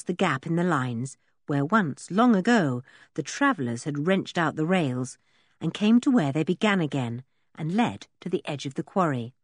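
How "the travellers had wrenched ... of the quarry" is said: usual speaking voice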